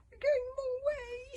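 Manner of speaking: very high, slow, loud squeal. A narrator's reading. Toothless protesting the wrong direction, high squeal. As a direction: squeal it like a small dragon. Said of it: "squealed Toothless".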